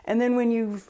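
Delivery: ordinary talk, nothing marked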